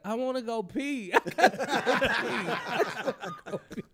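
high, laughing squeal of nerves; mock nervous panic. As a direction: act out comic desperation, voice shooting up. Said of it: "You're making me nervous".

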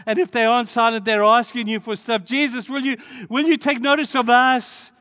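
loud, high pleading cry; high-pitched imploring shout. Retold or imitated. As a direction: call out to Jesus over the crowd. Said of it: "they're crying out".

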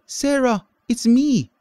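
high, loud happy call; calling out joyfully. A narrator's reading. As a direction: call across the yard to her daughter. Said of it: "she cried out".

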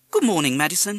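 high, loud greeting to a parrot; mother's greeting. A narrator's reading. Mother greeting the parrot at breakfast, coaxing. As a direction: call it sing-song to a pet bird.

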